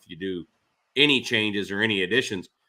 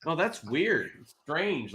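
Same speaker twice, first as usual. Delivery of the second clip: soft, higher feigned innocence; playing dumb; 5 semitones higher, 6 dB quieter, slower than usual. Homeowner feigning ignorance to the angry builder, soft and innocent. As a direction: act mildly puzzled, as if you know nothing. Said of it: "I'm just playing dumb".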